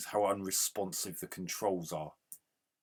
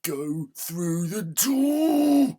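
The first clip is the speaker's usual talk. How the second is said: much higher, much louder and slow, dragging each word; furious scream; 10 semitones higher, 13 dB louder, much slower than usual. Real, not acted. Gamer screaming at his character to go through the door, furious. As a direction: scream it at the screen, at the end of your rope. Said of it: "I'm getting really angry".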